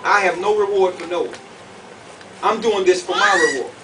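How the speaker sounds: normal talking voice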